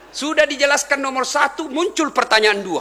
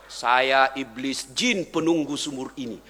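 jinn voice; 9 semitones lower than usual. Retold or imitated. The jinn in the well speaks.

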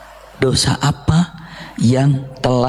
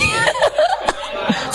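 high squealing laugh; 4 dB quieter than usual. Real, not acted. Preacher to the mothers, high squealing laugh. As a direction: burst into a shrill giggle.

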